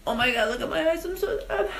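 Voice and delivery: playful fake whimper climbing high; silly mock sadness. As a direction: pretend to be tearfully sad, half laughing. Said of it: "I'm just being silly".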